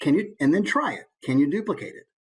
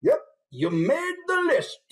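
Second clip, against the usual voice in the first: high, slow, drawn-out neighbour voice; comic book guy; slower than usual. Retold or imitated. Teacher imitating an actor's college neighbour, slow high character voice. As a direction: drag the words out, pitched well up. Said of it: "sit in a Barcalounger and go".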